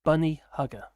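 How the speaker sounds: loud, deliberate dictation of each word; robot-like dictation. Shown as a demonstration. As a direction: say it firmly to voice-typing software. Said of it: "you have to talk like a robot".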